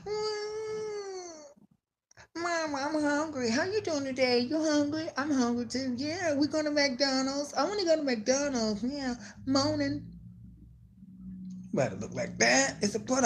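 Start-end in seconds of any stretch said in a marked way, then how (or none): 0.0-10.1 put-on very high small baby voice; newborn baby. Host imagining a baby fresh from the womb in a very high voice. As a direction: play a newborn babbling for food. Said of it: "You come out the womb".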